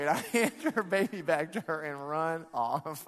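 usual speaking voice

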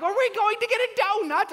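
loud, very high put-on child voice; hammed-up giddy kid squeal; weird excited kid. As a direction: squeak it out, bursting with a secret. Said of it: "they start acting really weird".

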